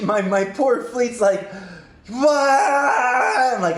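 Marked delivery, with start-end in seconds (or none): 2.1-3.5 high, loud drawn-out panic scream; imitated hysterical yell; freaking out. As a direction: wail like a whole fleet panicking. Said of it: "freaking out".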